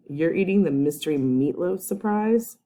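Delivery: usual speaking voice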